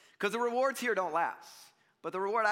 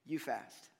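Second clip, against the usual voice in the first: normal talking voice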